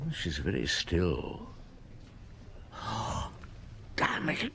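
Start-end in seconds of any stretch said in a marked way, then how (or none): 2.2-3.9 sharp breathless gasp of shock. Acted. Finds Comtesse dead in pew. 4.0-4.5 shocked curse. Acted. Discovers the body.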